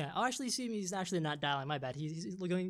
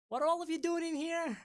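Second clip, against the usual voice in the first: suspicious guard; 11 semitones higher than usual. Acted. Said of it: "narrow and say".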